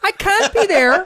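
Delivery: high, loud, drawn-out indignation; slow, strained high-pitched protest; Jordan Peterson. Acted. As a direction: protest loudly, stretching each word up high. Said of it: "Jordan Peterson?".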